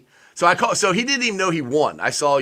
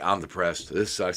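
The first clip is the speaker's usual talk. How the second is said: much lower, softer, dejected mumble; glum and low; 9 semitones lower, 8 dB quieter than usual. Retold or imitated. Harrison moping through a losing first half, low and dejected. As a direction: sound beaten down and glum.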